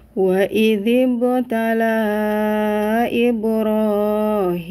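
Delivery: slow drawn-out chanted recitation; Quran recitation. Acted. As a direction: recite each word slowly and carefully.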